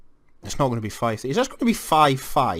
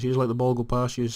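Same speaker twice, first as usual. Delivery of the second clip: ordinary talk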